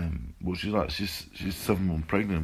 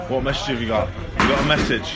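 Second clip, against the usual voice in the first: loud, high, playful prompting; cheeky loud prodding across the room; calling out to a friend; 5 semitones higher, 6 dB louder than usual. Real, not acted. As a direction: egg him on to speak up. Egging a friend for a birthday message.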